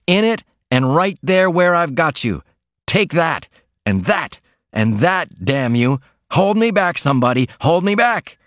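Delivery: a freed worker stamping on the manager's shirt, mock fury; hammed-up fury. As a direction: pretend you must be held back. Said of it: "he shouted".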